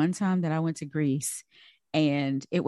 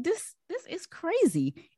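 amazed; 11 semitones higher, 4 dB quieter, slower than usual. Retold or imitated. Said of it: "And I was like".

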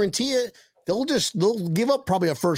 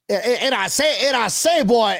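Foghorn Leghorn impression; 4 semitones higher, 4 dB louder than usual. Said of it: "Was that the chicken?".

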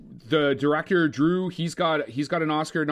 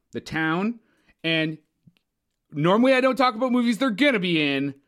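ordinary delivery

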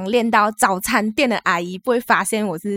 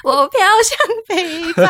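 mock dramatic; 10 semitones higher, 7 dB louder, slower than usual. Joking about drifting north.

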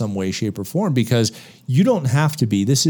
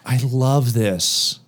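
savoring; slower than usual. Retold or imitated. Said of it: "but I'm like".

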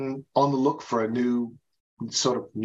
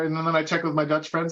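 ordinary talk, nothing marked

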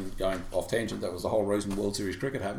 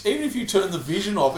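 ordinary talk, nothing marked

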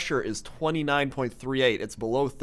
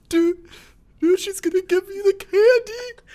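much higher, louder, frantic cry; mock panic; 9 dB louder than usual. Co-host shrieking a warning about the ghost's candy.

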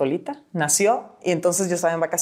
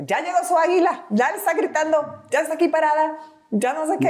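housekeeper announcing eagle; 9 semitones higher than usual. Retold or imitated. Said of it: "me decía la señora que trabaja en la casa".

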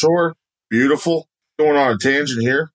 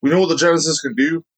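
ordinary talk, nothing marked

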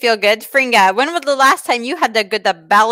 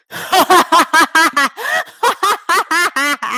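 put-on cackling belly laugh like an old witch; long witchy cackle; 6 semitones higher than usual. Shown as a demonstration. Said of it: "So that sound more like this".

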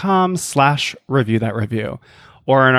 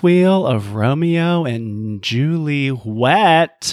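slow drawn-out; much slower than usual. Pretend. Drawn-out show title.

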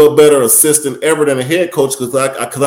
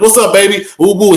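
higher, a bit louder teasing holler; playful hype greeting; 7 semitones higher, 4 dB louder than usual. Retold or imitated. Greeting the grumpy coach at practice, playful holler. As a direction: hype him up like a buddy.

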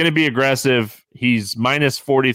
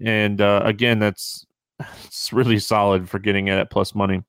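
normal talking voice

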